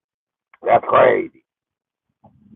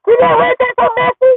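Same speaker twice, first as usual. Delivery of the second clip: put-on very high, loud gasping girl; little girl voice; 5 dB louder than usual. Little girl gossiping to dad, very high and loud. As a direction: squeal it like a shocked little girl.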